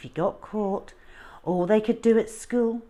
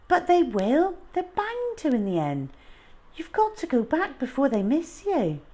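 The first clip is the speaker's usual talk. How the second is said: worried plea; 9 semitones higher than usual. A narrator's reading. Worried pleading with runaway.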